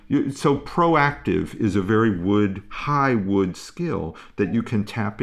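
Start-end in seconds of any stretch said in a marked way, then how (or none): none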